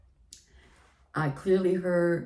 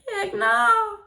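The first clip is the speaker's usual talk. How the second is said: much higher, louder mock-cry; panicked squeal; 5 dB louder, slower than usual. To the principal on her text, high panicked squeal. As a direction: squeal it in comic alarm. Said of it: "And I was like".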